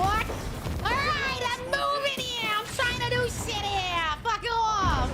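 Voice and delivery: high, yelled New Yorker impression; hammed-up, drawn-out New York accent. Shown as a demonstration. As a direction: holler like an irate New Yorker. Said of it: "Do New York?".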